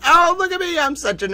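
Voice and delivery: very high, loud squeal of joy; giddy squeal. Real, not acted. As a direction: shriek with glee. Delighted at the emoji.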